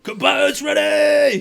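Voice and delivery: high, drawn-out referee's bellow; shouting announcer. Acted. Hobgoblin calling fighters to start, full shout. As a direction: holler it to the whole crowd. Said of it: "'cause he's shouting so much".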